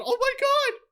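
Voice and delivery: very high squealed disbelief; high-pitched fan shock; astonished. Real, not acted. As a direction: squeal it in amazement. Astonished at the exchange.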